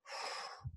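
long, soft exhale, mimicking a toddler; imitated calming breath. Dad copies his son telling him to calm down, long breathy exhale. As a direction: blow the breath out slowly and audibly. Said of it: "he looks at me and goes".

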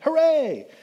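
a silly squeaky little cheer; mock cheer. The fooled king celebrates his recovery, high mock cheer.

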